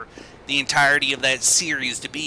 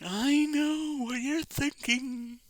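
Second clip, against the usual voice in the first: high slow voice; 10 semitones higher, 8 dB quieter, much slower than usual. Acted. Repeated line, first take.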